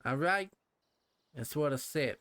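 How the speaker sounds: lightly done Brooklyn tough guy; a hint of Brooklyn swagger; Brooklyn accent. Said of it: "talking in my Brooklyn accent here".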